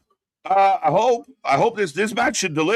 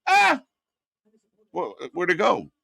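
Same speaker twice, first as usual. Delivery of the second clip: mock confused; 3 semitones higher than usual. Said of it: "kinda went like".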